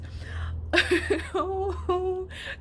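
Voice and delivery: high, drawn-out half-laughing whine; emotional wail. Real, not acted. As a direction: let out an overwhelmed, tearful-sounding "oh". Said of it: "I'm so emotional at this point".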